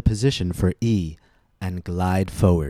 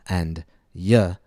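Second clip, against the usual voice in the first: ordinary talk, nothing marked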